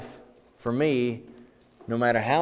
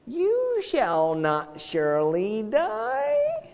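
much higher, slow, drawn-out serpent voice; Satan tempting Eve; 13 semitones higher, much slower than usual. Retold or imitated. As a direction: drawl the lie sweetly. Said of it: "He said to Eve".

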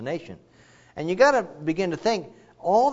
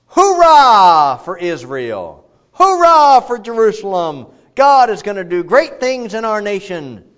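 much louder, slow, ringing hurrah; hearty cheer; 7 semitones higher, 12 dB louder, slower than usual. Retold or imitated. Imagined people cheer for Israel, slow and loud. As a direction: shout it like a rally.

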